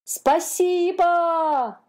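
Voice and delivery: high, very slow, loud called-out thanks; shouted thanks. A narrator's reading. Dolphins calling thanks after the departing ship, loud and drawn out. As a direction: call it long across the water to a leaving ship. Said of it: "кричали дельфины".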